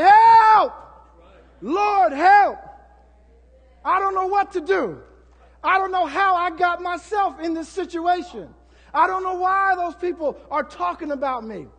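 high, loud, pleading cries of help; desperate shout for help. Acted. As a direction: yell it like you're drowning and only God can hear. Acted desperate cry for help.

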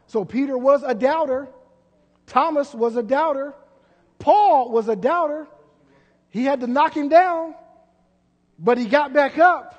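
rising pulpit shout; building preaching. Acted. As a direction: hammer each name harder.

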